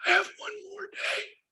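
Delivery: thin, strained voice of a sick dad; imitating dad's faint voice; strained weak voice. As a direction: push the words out with the last strength. Dying dad on the phone.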